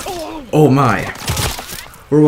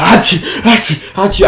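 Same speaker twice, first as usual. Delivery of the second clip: mock sneezes; 6 semitones higher than usual. To his friend, walking off after the fight, mock sneezing. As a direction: act out a fit of sneezing. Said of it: "I'm like".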